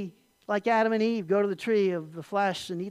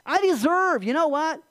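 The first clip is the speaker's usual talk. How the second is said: loud, high, indignant claim; entitled inner voice; 7 semitones higher, 5 dB louder than usual. Acted. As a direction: insist on what you are owed. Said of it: "you hear this voice".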